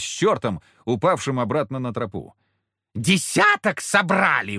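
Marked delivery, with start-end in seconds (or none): 3.0-4.6 high, slow mocking squeal; squealing devil. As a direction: shriek it like an insulted demon. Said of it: "взвизгнул он".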